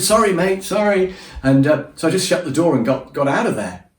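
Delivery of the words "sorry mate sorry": higher, hurried sorry mate; flustered apology. Retold or imitated. To the woken stranger, a flustered higher apology. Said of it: "and I sort of said".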